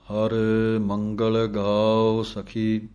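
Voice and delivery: slow chanted reading of the hymn; scripture recitation. Shown as a demonstration. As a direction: recite the verse slowly and devoutly.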